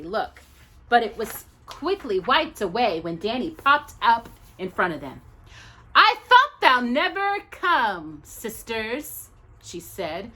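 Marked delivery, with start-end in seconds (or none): 5.9-9.3 high, slow, terrible fake British accent; hammed-up British accent; worst British accent. A narrator's reading. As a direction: ham a bad British accent. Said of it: "adopting the worst British accent".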